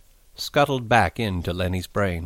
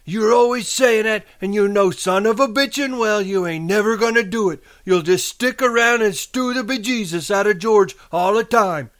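high, loud nagging rebuke; sharp scolding; 12 semitones higher, 6 dB louder than usual. A narrator's reading. Aunt accusing nephew of burdening George, sharp high scolding. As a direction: berate him in a raised, high voice. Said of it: "she said sharply".

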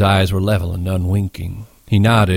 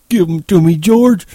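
put-on high, loud childlike plea; begging; 10 semitones higher, 7 dB louder than usual. A narrator's reading. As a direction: beg urgently in a simpleton's raised voice. Lenny begs for the pup.

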